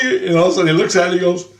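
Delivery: usual speaking voice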